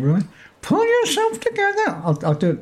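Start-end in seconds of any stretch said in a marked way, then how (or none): 0.6-1.9 high, squeaky little man. Shown as a demonstration. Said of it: "I'll do it less squeaky".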